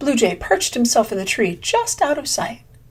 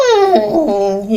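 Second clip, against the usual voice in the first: yawn; 6 dB louder than usual. Acted. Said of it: "yawned Greedy Squirrel".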